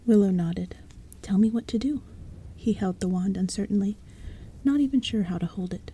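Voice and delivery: hushed, breathy-free whisper read; whispered storytelling. A narrator's reading. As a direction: bedtime story told in a whisper. Narration continues whispered.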